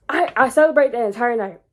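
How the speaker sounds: ordinary talk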